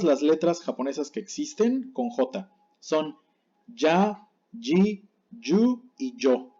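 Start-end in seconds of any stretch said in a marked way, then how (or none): 3.7-6.6 "ya, ji, yu y yo" with a strong Spanish ll sound, one by one; slow, spaced syllables, hard ll as in llave; Japanese J. Shown as a demonstration. Teacher reads out Japanese J syllables for learners, strong ll sound. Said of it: "suena fuerte, como la palabra llave".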